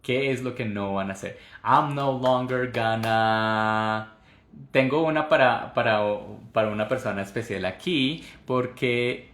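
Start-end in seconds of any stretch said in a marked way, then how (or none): 1.6-4.1 very slow, drawn-out prompt. Shown as a demonstration. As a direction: leave it hanging for students. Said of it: "prompting class slowly".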